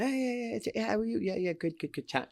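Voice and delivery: breezy small talk voice; chatty rock singer. Retold or imitated. [name] voiced, chatty.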